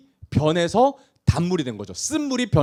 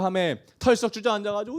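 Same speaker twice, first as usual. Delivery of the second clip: normal talking voice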